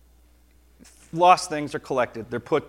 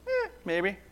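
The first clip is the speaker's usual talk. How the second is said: soft, higher-pitched, indifferent "eh"; noncommittal shrug; 5 semitones higher, 6 dB quieter than usual. Acted. Preacher answering his own question about a found coin, noncommittal. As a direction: shrug it off quietly.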